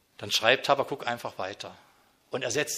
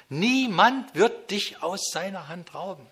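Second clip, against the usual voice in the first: ordinary delivery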